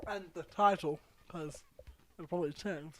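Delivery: talking round a mouthful of ice; words muffled by ice. Real, not acted. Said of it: "What happened to your voice?".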